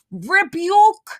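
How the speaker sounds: loud, high rebuking call; hammed-up loud rebuke; mock rebuke. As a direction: cast it out like a preacher. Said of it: "I'll be like".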